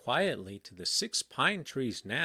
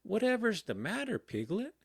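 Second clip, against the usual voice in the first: lightly voiced, higher questioning boy; puzzled boy; 10 semitones higher than usual. A narrator's reading. Christopher Robin just getting up, asks Piglet in mild puzzlement. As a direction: ask it with mild, high-pitched concern.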